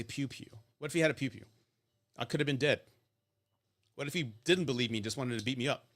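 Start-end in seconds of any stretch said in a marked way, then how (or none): 0.0-0.5 "pew pew" dropped soft and cute; coy euphemism. Real, not acted. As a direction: tiptoe around the word with "pew pew". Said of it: "I have to say".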